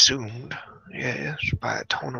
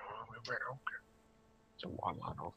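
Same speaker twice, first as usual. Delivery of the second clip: ordinary delivery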